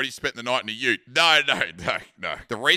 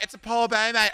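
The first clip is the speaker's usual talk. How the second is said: casual mock Katie; 10 semitones higher, 3 dB louder than usual. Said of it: "And she's like".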